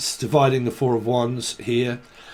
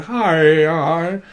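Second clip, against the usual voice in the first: a bubbly high sing-song of hi's, louder than her own talk; chirpy spirit voice; 5 semitones higher, 4 dB louder, much slower than usual. Retold or imitated. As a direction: parrot an over-eager voice saying hello. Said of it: "I can hear someone's voice going".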